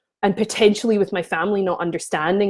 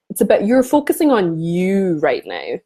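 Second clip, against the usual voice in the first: normal talking voice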